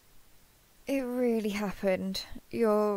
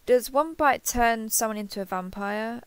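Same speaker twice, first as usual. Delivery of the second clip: normal talking voice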